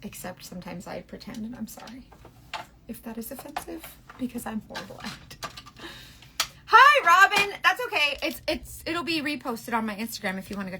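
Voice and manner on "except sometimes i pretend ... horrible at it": very soft, half-whispered confession; sheepish near-whisper. Real, not acted. Apologizing to viewers for her bad British, hushed and sheepish. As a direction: drop right down, almost under your breath.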